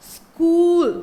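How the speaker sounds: स्कूल with a half s, clear s-k cluster; teacher says स्कूल, crisp sk at the start; model word. Shown as a demonstration. To the class, a teacher models स्कूल with a crisp s-k start. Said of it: "आधा सा की तरह हम use करते हैं तो आता है".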